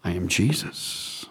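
very low, soft, slow declaration; hushed revelation. Acted. Jesus answering Saul on the Damascus road, hushed. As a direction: reveal who you are, slowly and quietly.

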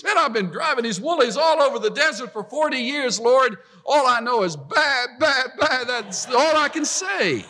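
a shepherd protesting he can't speak; Moses complaining. Acted. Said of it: "That's Moses".

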